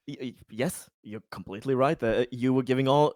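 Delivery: normal talking voice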